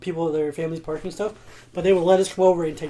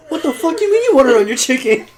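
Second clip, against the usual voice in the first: laughing disbelief; 8 semitones higher, 8 dB louder than usual. Retold or imitated. Mock outrage about honey.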